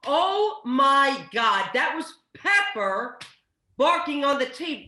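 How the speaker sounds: loud, slow, stunned; slow shocked realization. Acted. Carrie realizes the dog was on the tape, slow and stunned. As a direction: drag out the shock as it dawns.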